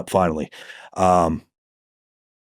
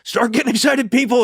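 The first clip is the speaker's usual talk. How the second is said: high-pitched, hyped-up mock yell; retold yelling; 13 semitones higher than usual. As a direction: re-enact hollering to rally a room. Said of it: "I'm yelling".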